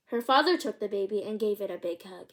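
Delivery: ordinary delivery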